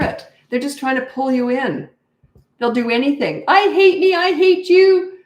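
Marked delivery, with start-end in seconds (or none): 3.5-5.1 high, loud mock tantrum wail. Toddler yelling at parent to pull them in, mock tantrum. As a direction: yell it like a toddler in a mock meltdown.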